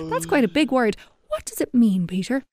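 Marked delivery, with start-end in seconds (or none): none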